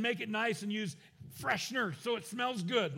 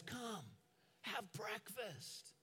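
very soft, slow, tender call; gentle invitation; 3 semitones higher, 12 dB quieter, much slower than usual. Acted. Jesus welcomes hungry disciples to the fire, gentle invitation. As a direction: invite them in almost under your breath.

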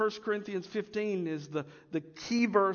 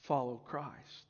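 slow, lower, mock-pious claim; a hint of holier-than-thou; lightly voiced super-spiritual believer; 6 semitones lower, much slower than usual. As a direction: draw out "I", then drop low and slow. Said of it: "the super spiritual".

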